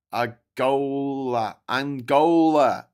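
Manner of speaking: very slow "Angola"; drawn-out punchline. Real, not acted. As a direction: stretch "Angola" for the joke. Reading a punchline answer.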